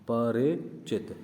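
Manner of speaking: परिचित drawn out slowly, syllables separated; wrong form said slowly. Shown as a demonstration. Teacher showing the exam trap, slowly. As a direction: stretch परिचित slowly to show the error. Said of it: "वो करेगा परिचित".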